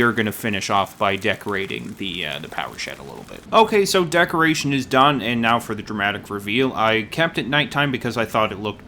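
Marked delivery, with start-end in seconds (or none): none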